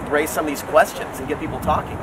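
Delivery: ordinary talk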